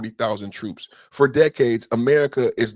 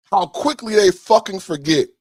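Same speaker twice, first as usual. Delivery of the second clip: louder, higher, bitter; angry outburst; 8 semitones higher, 6 dB louder than usual. Real, not acted. Repeating the line to viewers, now angry and louder. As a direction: let the anger spill over on the repeat.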